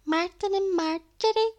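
high-pitched imitation of herself as a three-year-old; wee girly toddler voice. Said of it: "my wee voice, like, my accent".

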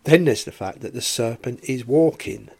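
ordinary talk, nothing marked

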